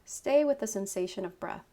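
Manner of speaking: normal talking voice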